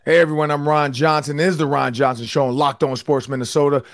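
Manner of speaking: usual speaking voice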